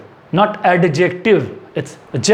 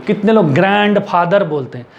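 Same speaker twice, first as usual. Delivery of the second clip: ordinary delivery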